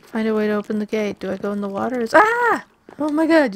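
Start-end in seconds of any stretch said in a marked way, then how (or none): 2.0-2.6 sudden high scream of fright; startled scream. Real, not acted. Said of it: "Yes, I screamed when I saw the spider". 3.0-3.5 startled. Real, not acted. Startled by spider.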